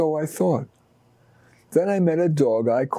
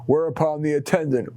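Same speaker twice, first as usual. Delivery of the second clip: usual speaking voice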